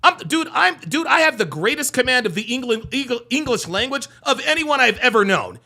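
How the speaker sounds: ordinary talk, nothing marked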